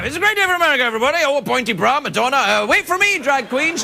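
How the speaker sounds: high-pitched, excitable rush of catchphrases; frantic monologue recap. Acted. Host recapping the monologue against a thirty-second clock, frantic and high. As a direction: race the clock, voice pushed high.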